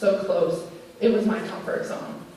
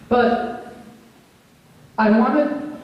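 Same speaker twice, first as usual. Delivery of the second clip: teary; 4 semitones higher, 3 dB louder, much slower than usual. Real, not acted. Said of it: "I'm getting teary-eyed".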